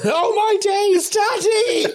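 put-on high, slow, loud child's shriek; mock panic, high and loud, crying for daddy; screaming child. Child whose hands are cut off.

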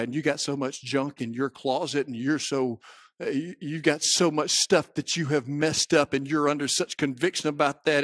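ordinary talk

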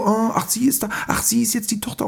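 high, cooing mock awe; imitating the crowd gushing over the sister, pitched high; mock fawning. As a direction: gush like a starstruck crowd.

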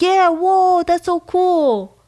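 put-on high, slow, amazed kid voice; a hammed-up amazed classmate; mock excited kid. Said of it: "And they're like".

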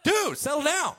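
high, loud scoff. Retold or imitated. Preacher to a pious show-off, a high loud scoff. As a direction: tell them to calm down. Preacher scolds mock believer.

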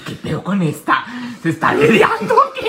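high, laughing disbelief; laughing mock outrage. Friend retells overhearing snoring, laughing incredulous. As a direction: gasp it out through laughter, slowly. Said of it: "y yo dije".

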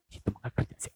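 whispered gossip, half to herself; gossipy whisper. Retold or imitated. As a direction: hush it like passing on a rumour. Gossiping neighbour quoted. (bisik-bisik bergosip)

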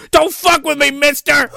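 high-pitched hammed-up yell; mock threatening shout. Man popping up in the back seat, mock threatening shout. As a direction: burst out with a comic threat.

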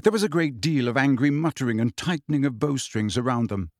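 plain reading voice

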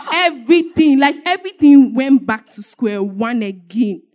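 usual speaking voice

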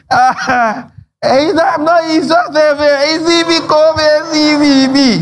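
high, flustered excuse; caught out stammering. Retold or imitated. Caught at the club, stammering a high, guilty excuse.

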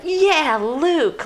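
high-pitched acted cheer; hammed-up whoop of approval; mock cheer. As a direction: cheer him on like a delighted crowd. Voicing prisoners cheering Luke.

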